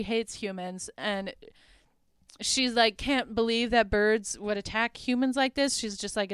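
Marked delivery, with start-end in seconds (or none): none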